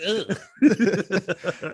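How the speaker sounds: laughing, high shuddering ugh; shivering ugh. Retold or imitated. Mimicking the shiver, laughing and high. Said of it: "She's like".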